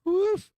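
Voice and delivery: high, loud celebratory woo; a sudden cheer at the screen; excited whoop. Real, not acted. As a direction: whoop in real triumph. Cheering a stock move live.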